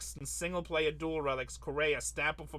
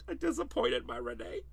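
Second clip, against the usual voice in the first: mock crying; 8 semitones higher, 4 dB quieter than usual. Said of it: "[name] is crying right now".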